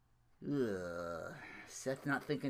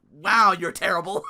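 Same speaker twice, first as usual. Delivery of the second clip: laughing jab; 2 semitones higher, 8 dB louder than usual. Retold or imitated. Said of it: "I'm like".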